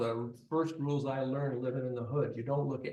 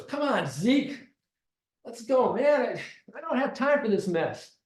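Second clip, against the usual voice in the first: raised, higher, louder voice; impatient urging; 9 semitones higher, 6 dB louder than usual. Retold or imitated. Gunman's friend urging Zeke to go, impatient shout. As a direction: push him to hurry up, loud and high.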